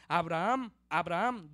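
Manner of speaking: loud, ringing double call. Acted. The angel of the Lord calls Abraham from heaven, loud calling. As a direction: call the name out twice, forcefully.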